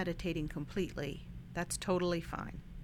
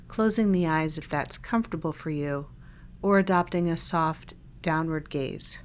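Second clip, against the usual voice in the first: calm body-scan guidance with long silences between phrases; unhurried, even meditation-guide voice; guided meditation; 4 dB louder than usual. Real, not acted. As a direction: lead listeners gently through a meditation, leaving lots of space.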